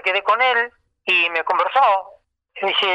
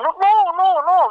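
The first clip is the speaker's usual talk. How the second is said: very high, louder mock cry of no; stopping the singer; 11 semitones higher, 4 dB louder, slower than usual. Maestro halts the young singer, high and loud. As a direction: cut the singer off with a high, urgent no.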